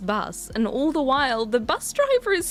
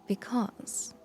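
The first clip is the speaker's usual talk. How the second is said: hushed, breathy reveal; spooky storyteller whisper; dramatic whisper; 7 dB quieter than usual. Acted. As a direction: drop to a whisper for suspense.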